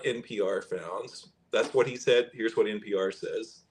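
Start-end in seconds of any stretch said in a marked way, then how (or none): none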